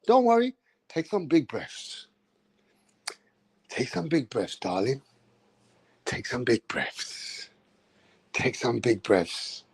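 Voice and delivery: calm her through the pain gently; soothing nurse. Retold or imitated. Nurse coaching a woman in labour, softly soothing. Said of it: "the nurse will say".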